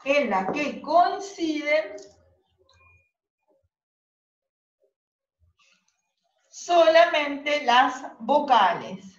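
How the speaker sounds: slow dictation, phrase by phrase; dictating. Real, not acted. Teacher dictating the second definition to students, slow dictation. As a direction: stretch it out for note-takers.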